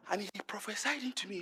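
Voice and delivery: ordinary talk, nothing marked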